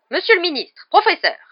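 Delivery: high, loud, indignant nurse; angry cry. A narrator's reading. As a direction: scold the officials sharply. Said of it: "s'écria madame Pomme Fraîche avec colère". (cri de colère)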